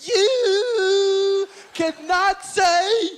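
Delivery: pissed-off, loud, high, hugely stretched scolding; hammed-up indignant outburst with long-held words; Bill Cosby. Cosby scolding the comic for the curse, pissed-off impersonation. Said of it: "Bill got pissed and said".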